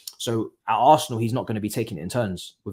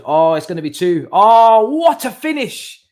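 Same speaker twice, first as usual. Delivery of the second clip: rising, louder cry as the goal goes in; excited yell; 9 semitones higher, 13 dB louder, slower than usual. Real, not acted. Watching the goal go in, real excited shout. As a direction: shout out the goal, pitch climbing.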